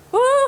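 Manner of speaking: high woo while straining; strained woo. Real, not acted. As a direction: push out a breathy woo. Feeling the burn in bridge.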